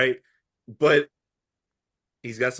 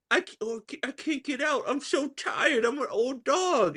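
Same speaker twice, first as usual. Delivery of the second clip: higher, softer mock weary old dog; tired old dog; 7 semitones higher, 5 dB quieter than usual. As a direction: whine it like a worn-out old dog. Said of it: "his famous".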